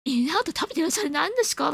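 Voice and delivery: 息をのんで高い声、早口で驚くファンを演じる; excited fan impression, high-pitched gasp then a hushed, eager question; 日本人ファンの真似. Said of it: "今、日本人が". (大谷ファンの日本人が驚く真似)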